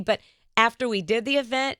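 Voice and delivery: ordinary talk, nothing marked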